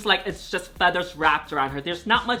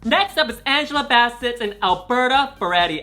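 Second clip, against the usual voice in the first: ordinary talk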